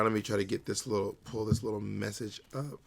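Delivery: ordinary talk, nothing marked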